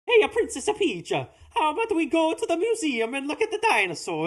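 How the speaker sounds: high, cartoon Italian Mario accent. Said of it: "He doesn't go like".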